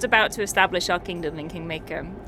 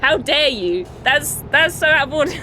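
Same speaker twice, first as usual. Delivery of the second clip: raised, louder indignant huff; my character gets indignant at the lord; annoyed outburst; 5 semitones higher, 4 dB louder than usual. Retold or imitated. Player voicing her character, indignant at the lord's condition, louder and higher. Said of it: "I got really annoyed".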